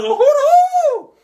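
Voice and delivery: high, loud siren-like hooting; long falsetto hoo-hoo; bullhorn whoop. Retold or imitated. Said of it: "with a bullhorn talking about".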